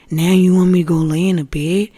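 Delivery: loud indignant question; arguing. Retold or imitated. Woman arguing with the voices ordering her to bed, indignant. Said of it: "Then I start arguing with them".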